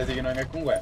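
usual speaking voice

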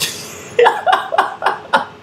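high, loud burst of laughter; big laugh. Real, not acted. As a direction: crack up helplessly. Cracking up at the clip's remark.

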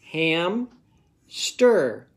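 "Ham" and "stir" split by a pause; syllables apart. Shown as a demonstration. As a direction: say "Ham, stir" as two parts. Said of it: "do my parts".

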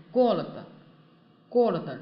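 过了的 with a strong first syllable, like го́род; stress on first о. Shown as a demonstration. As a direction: punch the first syllable of 过了的, let the rest drop. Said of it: "重音在这个о上面".

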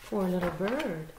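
ordinary delivery

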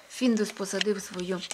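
usual speaking voice

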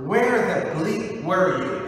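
loud accusing "Where the bleep were you?"; angry demand. Retold or imitated. Grieving sisters confronting Jesus, loud and accusing. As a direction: hurl the question at Jesus. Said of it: "In other words".

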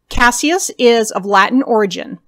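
normal talking voice

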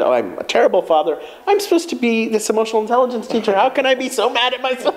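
fast, mock-ashamed wail at himself; self-blaming inner voice. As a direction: berate yourself quickly, voice climbing.

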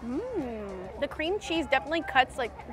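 chewing between words; talking with food in mouth; mouth full of dessert. Real, not acted. Seen 0: Left speaker chews forkful of strawberry dessert, not pizza, while talking.

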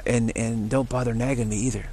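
normal talking voice

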